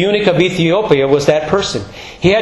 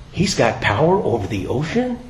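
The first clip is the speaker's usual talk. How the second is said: awed gasp; 5 semitones lower, 7 dB quieter than usual. Retold or imitated. Disciples awed at calmed storm.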